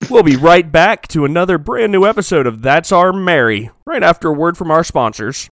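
brisk, projected ad-break throw; punchy show-host sign-off; mock TV announcer. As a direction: announce it like a sitcom going to commercial.

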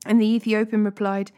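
ordinary delivery

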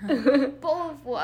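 exaggerated British glottal-stop accent. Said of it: "I have to be British".

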